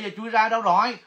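ordinary talk, nothing marked

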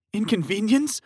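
high, loud incredulous cry; outraged shout. Acted. Fiancé outraged at the manager, shouting. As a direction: throw the word back in anger. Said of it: "Tyler shouted".

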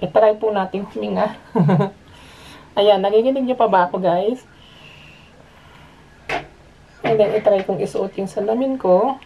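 muffled behind face mask; speaking into covered mouth; through a respirator mask. Real, not acted. Seen 2: Respirator covers mouth and nose in every frame; references show it removed.

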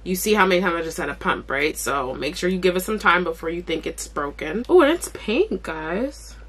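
normal talking voice

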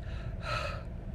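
unvoiced rush of air; just breathe out air; breathy air. Shown as a demonstration. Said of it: "just adding in air".

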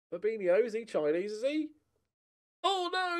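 ordinary talk

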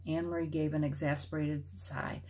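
usual speaking voice

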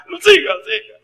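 very high, pleading lady; tearful lady. Retold or imitated. Voicing the lady weeping. (señora llorosa pidiendo que siga, voz muy aguda)

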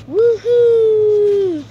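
loud high whoop mid-bounce; on a trampoline. Real, not acted. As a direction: cheer while bouncing up and down. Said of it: "I'm bouncing on the little trampoline".

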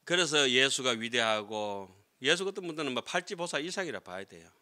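ordinary talk

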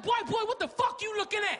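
high, confrontational challenge; intimidating woman. Acted. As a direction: act a woman trying to punk him out. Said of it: "this woman tried to punk me out".

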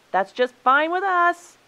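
high, loud, drawn-out retort; defiant shout. A narrator's reading. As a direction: shout it back as you storm off. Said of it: "shouted the animals".

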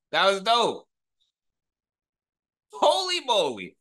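ordinary talk, nothing marked

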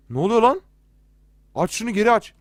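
high, loud startled outburst; alarmed. Real, not acted. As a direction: demand a way out in alarm. Startled by game, demands door open.

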